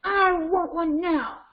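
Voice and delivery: high, drawn-out mock demand; angry child. Child refused a popsicle before dinner, mock angry demand. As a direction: whine it slowly like a demanding child. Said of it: "Cheetah is gonna get angry".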